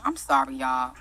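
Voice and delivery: suddenly low and soft; sheepish apology. Real, not acted. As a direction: drop right down to apologise. Apologises for having yelled.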